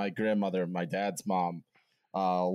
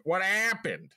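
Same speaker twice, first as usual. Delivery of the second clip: high, alarmed Boston dad; imitated worried dad gone full Boston; dad's resurfacing accent; 10 semitones higher, slower than usual. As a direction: blurt it in a stressed Boston accent. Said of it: "he was like".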